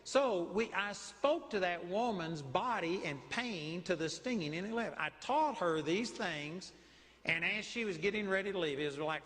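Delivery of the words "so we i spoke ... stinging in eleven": usual speaking voice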